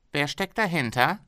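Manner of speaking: a pushy boss bargaining hard; haggling factory owner. Acted.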